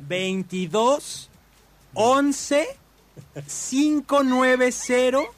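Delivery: number read very slowly, group by group. Shown as a demonstration. Host dictating the station's phone number to listeners, slowly. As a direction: dictate the digits with long pauses between groups. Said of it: "Ahí está despacito".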